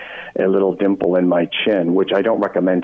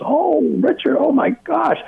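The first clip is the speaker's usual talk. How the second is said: much higher, gushing mock relief; mock relieved gasp; slower than usual. Relieved star thanking the host for a ride, mock high gush. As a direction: squeal out the grateful relief.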